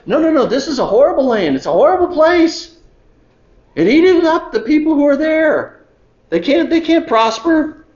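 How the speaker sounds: high, fast alarmed whine; a mild hammed-up panic; panicked complaint. Spies frightening Israel about the land, high alarmed whine.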